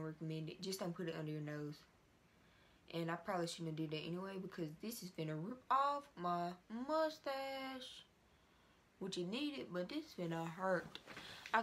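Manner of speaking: stiff tight facial skin; limited cheek and lip movement; talking with face mask on. Real, not acted.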